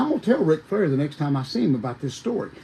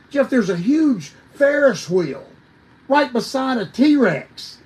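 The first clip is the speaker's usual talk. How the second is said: excited awe; 7 semitones higher than usual. Real, not acted. Describing the festival with delight.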